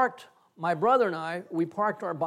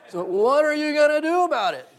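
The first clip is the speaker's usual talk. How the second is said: mocking taunt; 10 semitones higher, 8 dB louder than usual. Voicing the older bully's taunt.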